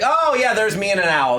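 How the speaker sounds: usual speaking voice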